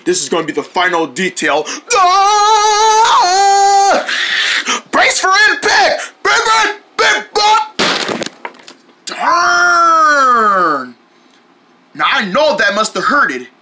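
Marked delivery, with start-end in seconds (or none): 1.9-11.0 high alarmed yell; bracing shout. Acted. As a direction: yell before the crash. Crash landing.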